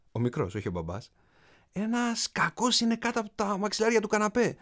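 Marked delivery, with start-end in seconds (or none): 1.7-4.6 high-pitched pretend alarm; mock-terrified shout. A narrator's reading. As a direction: cry out as if scared, playing along with a child. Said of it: "του φώναξε ο μπαμπάς του δίθεν έντρομος".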